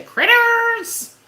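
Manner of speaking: high, loud shrill-free bark of a name; barking orders. Retold or imitated. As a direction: call the pet sharply like an impatient owner. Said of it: "Lady A used to".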